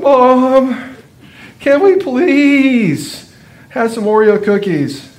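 put-on high, slow, dramatic child begging; heartfelt plea. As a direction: stretch it out like a desperate little boy. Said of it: "It was a heartfelt plea".

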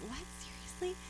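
breathless high-pitched what, seriously; incredulous disbelief. Retold or imitated. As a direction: disbelief that comes out almost as a gasp. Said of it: "going like".

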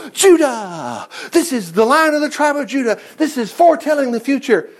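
high, hammed-up proclamation; mock over-eager interpreter. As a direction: proclaim it like a grand revelation, mockingly. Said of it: "Don't jump ahead and go".

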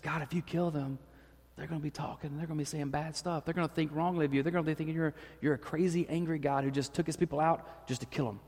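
soft, earnest plea to God; hushed, urgent pleading; pleading Moses, hushed. Retold or imitated. Moses quietly pleads with God to spare them.

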